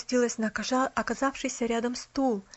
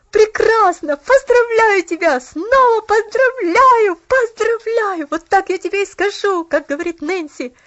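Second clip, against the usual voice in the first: very high, loud, jubilant cheering; overjoyed congratulations; 8 semitones higher, 11 dB louder than usual. A narrator's reading. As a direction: shout congratulations with delight.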